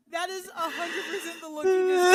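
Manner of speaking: high, loud laughing. Real, not acted. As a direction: laugh it out big. Laughing loudly.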